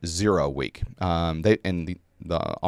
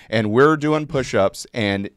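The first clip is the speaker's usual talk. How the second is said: normal talking voice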